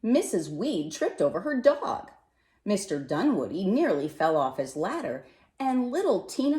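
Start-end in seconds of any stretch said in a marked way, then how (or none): none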